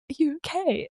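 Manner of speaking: a hint of fear in a soft high question; low-key scared. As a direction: ask it quietly, a bit frightened. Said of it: "Like, low-key really scared".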